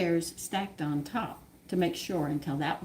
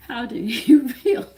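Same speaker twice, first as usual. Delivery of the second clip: higher and louder, breaking into laughter; laughing question; 4 semitones higher, 5 dB louder than usual. Retold or imitated. Father asking his son how he feels, laughing as he asks. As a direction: ask it while cracking up.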